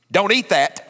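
high, loud nagging wife; husband nagged by his wife, shouting. Acted. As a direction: scold him loudly.

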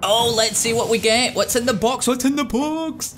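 playful excitement. Pretend. Streamer eager to see what they got, playfully excited. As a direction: ramp up the mock suspense, pitch climbing. Excited riff on opening a reveal.

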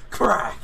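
high, loud mock crack; loud crack sound effect. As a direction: shout the hit noise.

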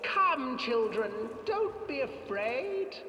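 high, slow sugary crone; witch voice. Acted. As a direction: coax them in like a witch.

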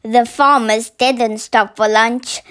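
plain reading voice

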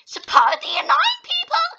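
high, loud outro cry; loud sign-off. Acted. Youtuber shouting a sign-off to the viewers, then laughing.

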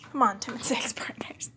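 hushed whispered plea. Real, not acted. Urging the game in a whisper.

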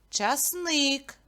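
часнИк, slow with a drawn-out И; stress on last syllable. Shown as a demonstration. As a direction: stretch НИК in часник. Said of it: "на звук И".

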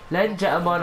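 ordinary talk

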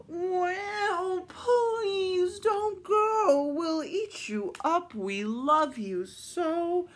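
high, slow, tearful begging; wild things begging Max not to leave; crying plea. Acted. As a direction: wail it like a heartbroken monster. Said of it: "the wild things cried".

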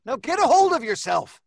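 loud high sharp command; firm outburst. Acted. Husband ordering hysterical wife to stop, loud. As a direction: snap her out of it.

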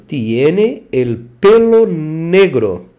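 slow, clear demo of the sentence; teacher modelling slowly; slow model sentence. Shown as a demonstration. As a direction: say it slowly for learners to repeat. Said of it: "slow model sentence".

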